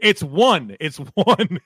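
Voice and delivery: ONE with a clear uh vowel; corrective pronunciation. Real, not acted. Host correcting his co-host, emphatic standard pronunciation of ONE. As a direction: stress one, rhyming with sun.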